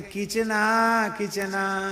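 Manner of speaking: slow, doting, drawn-out call; imitating loving Bengali devotion; affectionate. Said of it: "बहुत लाड से कहें".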